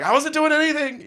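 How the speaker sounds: very high, squeaky guilty denial; defensive denial. Retold or imitated. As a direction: protest innocence in a high squeak. Caught by security, defensive.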